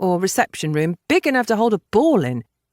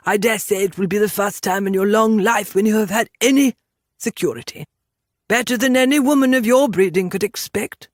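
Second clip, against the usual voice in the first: haughty gentleman making an offer; lofty, patronising character voice; condescending Englishman; 3 semitones higher, 3 dB louder than usual. Acted. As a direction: talk down to her as a superior Englishman.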